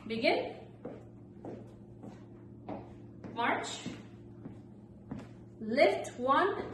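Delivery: upbeat fitness class calls; exercise instructor. Real, not acted. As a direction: call the moves out cheerfully.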